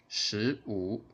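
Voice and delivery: normal talking voice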